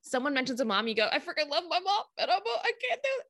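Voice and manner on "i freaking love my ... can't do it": very high, hammed-up blubbering; mock tearful breakdown. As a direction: play falling apart in tears, half laughing. Acting out tearful breakdown over mom.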